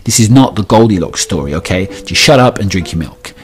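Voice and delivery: loud, fed-up telling-off; stern father. Retold or imitated. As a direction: lay down the law to a toddler. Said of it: "I remind her in no uncertain terms".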